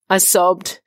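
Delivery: plain reading voice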